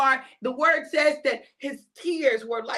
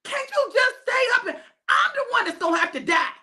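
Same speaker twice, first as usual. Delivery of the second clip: frustrated teacher yelling at his sleeping friends; frustrated Jesus; 8 semitones higher than usual. Acted. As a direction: scold the sleepy disciples, half laughing. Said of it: "Jesus was frustrated with them".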